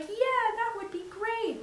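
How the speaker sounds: high, slow, sugary fake agreement; fake cheerful yes. Pushed into volunteering, high slow fake-cheerful yes. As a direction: force a bright grinning yes. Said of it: "with a big grin and saying yes".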